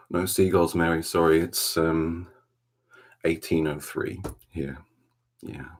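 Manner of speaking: ordinary talk, nothing marked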